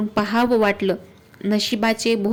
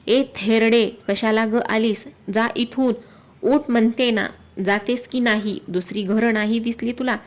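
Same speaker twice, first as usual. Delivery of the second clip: plain reading voice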